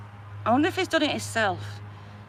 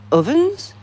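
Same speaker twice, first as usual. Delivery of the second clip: incredulous question; 8 semitones higher, 8 dB louder than usual. Real, not acted.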